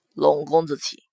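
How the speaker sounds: deep, restrained male voice; low, formal invitation; courteous prince. A narrator's reading. Prince invites his guest ahead, low and formal.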